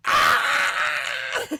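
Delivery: put-on high, loud kid's pain scream; shrill yelp of pain; mock scream. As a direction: scream like your eye's on fire. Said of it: "in a house going".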